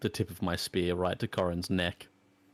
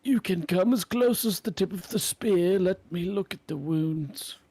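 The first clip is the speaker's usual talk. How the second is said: higher, wary in-character warning; gruff cautious hero; 9 semitones higher than usual. Acted. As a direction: keep the wolf at spear's length. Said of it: "And I say".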